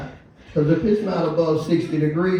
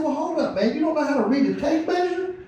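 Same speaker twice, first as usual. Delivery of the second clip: high, fast, laughing disbelief; exasperated disbelief; 9 semitones higher, faster than usual. Retold or imitated. Boss stunned teen can't read tape measure, high laughing disbelief.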